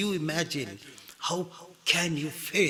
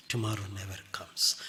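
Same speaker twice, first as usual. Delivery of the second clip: Tomorrow said softer and quicker; quieter repeat; 5 semitones lower, 10 dB quieter, faster than usual. Shown as a demonstration. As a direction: let the Tomorrow line fall away softly. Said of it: "Don't say tomorrow".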